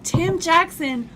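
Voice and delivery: excited. Real, not acted. Excited festival shout-out.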